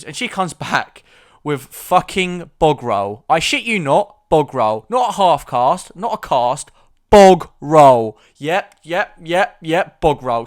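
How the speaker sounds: loud, high incredulous retelling; outraged disbelief. Acted. Patient telling how the nurse came back with bog roll, outraged disbelief. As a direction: belt out each absurd detail, punching it.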